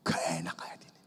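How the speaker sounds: hushed, whispered confession; whispered aside. Real, not acted. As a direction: whisper it to yourself. Private aside. (bisik pelan)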